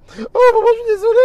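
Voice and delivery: high, loud, anguished sorry; wailing apology. Retold or imitated. A distressed caller wails his apology to the pastor. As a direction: cry out your apology.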